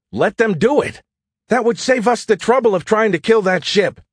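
high-pitched, fed-up exclamation; exasperated outburst. Acted. Urging Skippy to let the Guardians attack, exasperated.

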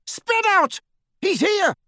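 high, urgent call to his fellows; yelled order. A narrator's reading. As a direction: yell orders to the gang. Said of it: "and then to his fellows".

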